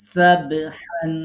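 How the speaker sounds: সাবহান drawn out very slowly, with a loud bounce on the b; teacher showing the bounce in সাবহান; slow qalqala demo. Shown as a demonstration. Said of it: "একটু কলকলা করতে হবে".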